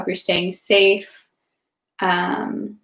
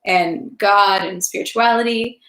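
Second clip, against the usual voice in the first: plain reading voice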